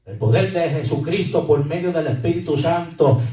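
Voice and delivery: loud, rising declaration of power; full-force preaching. Acted. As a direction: preach it out to the congregation.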